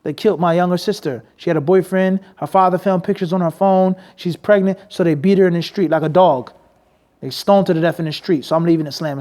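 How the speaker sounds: ordinary talk, nothing marked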